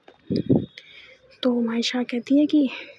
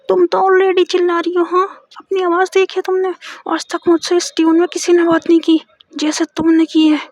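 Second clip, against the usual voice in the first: angry comeback; 3 semitones higher, 9 dB louder than usual. Retold or imitated. Girlfriend scolding Maysha for her tone, furious and loud. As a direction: rebuke her sharply, offended. Girlfriend fires back in a fight.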